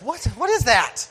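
high, loud mock surprise at the ladies; a loud shocked squawk. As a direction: squawk it out, playfully appalled. Mocking ladies' closeness.

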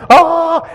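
very high, loud scream of panic. Retold or imitated. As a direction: shriek as the gopher claws. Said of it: "I'm like".

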